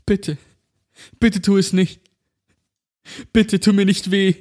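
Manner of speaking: high, pleading and tearful; holding back sobs. Acted. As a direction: beg through tears. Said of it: "schluchzte er".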